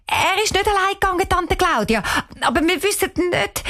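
put-on high, hurried girl's reply; quick reply. A narrator's reading. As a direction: blurt it out fast, pitched well up. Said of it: "Cheyenne sagt schnell". (schnäll und höch usegredt)

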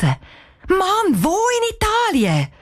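high, exasperated cry; calling out. A narrator's reading. Cheyenne reacting to the message from Italy, calling out. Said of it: "Cheyenne ruft laut raus".

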